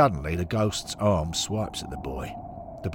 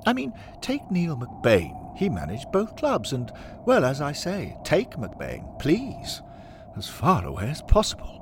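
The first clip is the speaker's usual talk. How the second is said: high, emphatic, lightly pompous voice; disdainful club father; 9 semitones higher than usual. Acted. Henry dismisses a manager of both clubs, pompous disdain.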